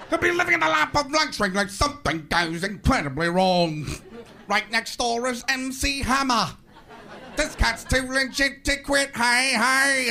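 hammed-up TV host, drawn out; Robin Leach. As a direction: announce it like an overblown show host. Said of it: "Robin Leach isn't laughing".

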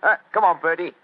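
loud, high Cockney; hearty Cockney call; Cockney accent. Acted. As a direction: chivvy your mate along in Cockney. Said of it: "My Cockney accent doesn't compare with yours".

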